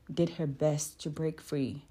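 ordinary delivery, nothing marked

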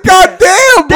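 high, loud put-upon god damn; retold exasperation. To friend banning him from work, mock exasperated and loud. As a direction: whine it like he's fed up. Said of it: "I'm like".

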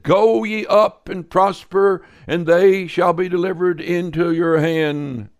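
high, loud, slow mocking echo of the prophets; mock the other prophets' promise. Prophet mocking the false prophets to the king, loud and sarcastic. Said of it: "he said that in a mocking tone".

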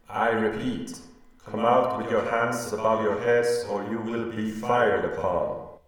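bellowed military warning; shouted surrender order. Acted. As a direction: call it out to a whole castle. Surrender order to surrounded castle.

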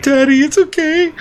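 sweet little son. Retold or imitated. Toddler son comforting his crying dad, high sweet voice. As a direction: coo it like a small boy soothing Dad. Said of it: "he's like".

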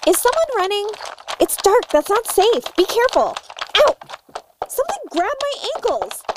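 uneasy, calling after an unseen runner; alarmed. Acted.

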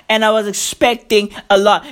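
ordinary talk, nothing marked

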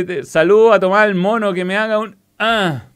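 talking with a mouthful; words round a bite of food; mouth full. Real, not acted. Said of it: "Espérate que está con la boca llena".

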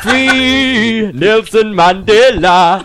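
put-on slow, drawn-out South African accent. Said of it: "I thought Nelson Mandela had walked in".

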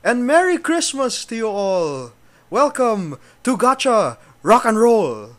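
loud, high festive welcome; excited. Acted. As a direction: boom out the show welcome. Loud festive show opening.

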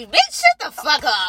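slow, loud angry snap; angry retort. Retold or imitated. To the girl who joined the walk, slow loud angry snap. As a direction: tell her off, loud and slow.

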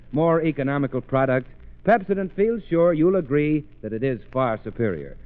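usual speaking voice

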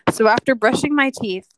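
ordinary talk, nothing marked

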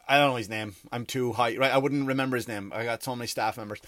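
ordinary talk

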